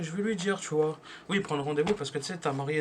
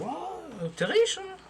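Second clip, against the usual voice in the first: much higher mock wow; mock amazement; 14 semitones higher, much slower than usual. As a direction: squeal it in fake awe at the coins. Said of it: "je dis". (émerveillement moqueur, beaucoup plus aigu)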